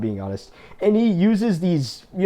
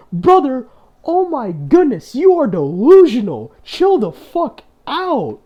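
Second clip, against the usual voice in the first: much higher, disbelieving outburst; exasperated outburst; 8 semitones higher, 5 dB louder, slower than usual. Real, not acted. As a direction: throw up your hands, pitch soaring in disbelief. Exasperated rant at a creator.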